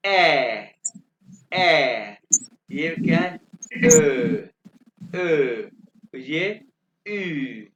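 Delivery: umlaut letters called out loud and apart; umlaut demo. Shown as a demonstration. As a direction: show how each umlaut sounds. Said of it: "इनको pronounce कैसे करना है".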